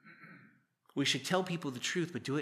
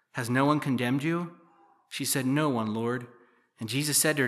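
louder, measured Bible reading voice; scripture reading; 4 dB louder than usual. Acted. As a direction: read the passage out to the congregation. Reads the scripture passage aloud.

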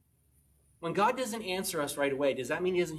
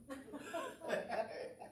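breathy laugh; 10 semitones higher, 12 dB quieter than usual. Real, not acted. Laughing at himself.

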